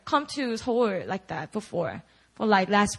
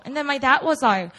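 ordinary talk, nothing marked

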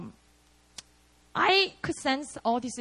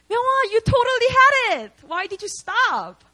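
loud, high-pitched exclamation; emphatic encouragement; 10 semitones higher, 7 dB louder than usual. Retold or imitated. Brian to fallen friend, loud emphatic urging. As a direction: imitate a friend crying out in disbelief. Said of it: "I cannot forget what Brian actually told me".

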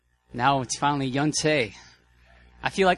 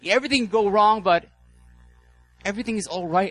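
much higher and louder impassioned sermon; fiery preaching; 7 semitones higher, 6 dB louder than usual. Acted. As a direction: proclaim it to the whole hall at full force. Preaching at full force.

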